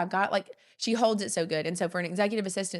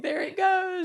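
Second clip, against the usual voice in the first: much higher, laughing delivery; laughing relief; 11 semitones higher, slower than usual. Retold or imitated. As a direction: laugh through it, voice lifted high. Laughs retelling easy forwarding.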